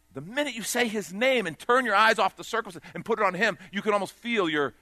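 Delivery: loud, rising preacher's call; full-force preaching. Acted.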